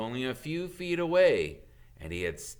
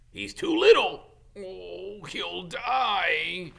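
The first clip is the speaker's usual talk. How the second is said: much higher and louder, wailing worry; distressed wail; 9 semitones higher, 6 dB louder, much slower than usual. Acted. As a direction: plead in near panic. Hagrid distressed about dragon.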